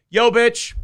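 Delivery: loud, high taunting greeting; taunting shout. Retold or imitated. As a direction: call out to provoke her. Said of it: "I'd go".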